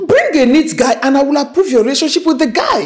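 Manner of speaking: loud, high-pitched exasperation; angry outburst. Acted. Mother demands a better boyfriend for her daughter, angry outburst. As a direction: burst out over the daughter's protest.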